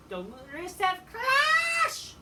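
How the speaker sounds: slow, high, shouted move name; announcer call. Retold or imitated. Fan calling Miro's finisher like an announcer, drawn-out shout.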